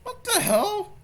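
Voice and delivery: high shocked exclamation. Real, not acted. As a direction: blurt it out in high disbelief. Shocked at the clip.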